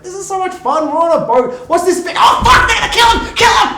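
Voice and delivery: high, loud, building to a shout; mock excited yelling. Fan at home yelling at the screen, building excitement. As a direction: squeal with glee, then yell for the kill. Acting his own excited reaction watching.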